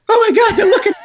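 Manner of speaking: very high, loud comic squeal; hammed-up high-pitched freak-out; panicked inner voice. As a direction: squeal in mock panic at being watched. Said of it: "I was just like".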